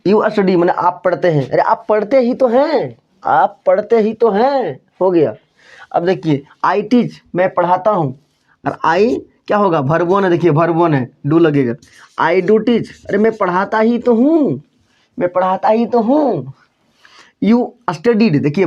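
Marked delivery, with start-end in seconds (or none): 1.5-4.8 higher, stress on ही तो, you do study; emphatic. Shown as a demonstration. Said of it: "जोर देकर किसी sentence को बोला जाता है". 13.1-16.5 higher, stress on ही तो, you do study; emphatic. Shown as a demonstration. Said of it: "जोर देकर किसी सेंटेंस को बोला जाता है".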